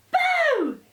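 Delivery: huge high shout; shouted boo. A narrator's reading. Elmer shouts to startle the still herd, full loud boo. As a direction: scare them all with one big shout. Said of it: "at the top of his voice, shouted".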